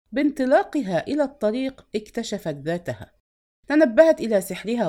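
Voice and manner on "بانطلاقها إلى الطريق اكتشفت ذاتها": ordinary delivery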